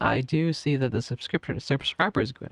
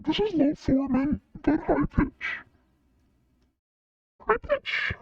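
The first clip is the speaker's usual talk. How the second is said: much higher, squeaky voice-changed delivery; high pitch voice changer; slower than usual. Shown as a demonstration. Streamer tests the high pitch setting for chat, squeaky and high. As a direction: let the changer push you way up high. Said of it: "Uh, high pitch".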